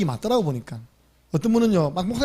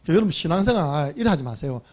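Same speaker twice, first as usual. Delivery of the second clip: normal talking voice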